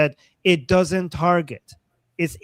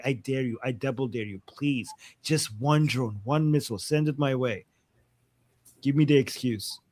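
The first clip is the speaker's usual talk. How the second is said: daring taunt; 3 semitones lower, 5 dB quieter than usual. Acted. Israel daring Iran to send one missile, quiet taunt. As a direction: softly egg them on, pleading under the dare. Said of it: "Israel is like".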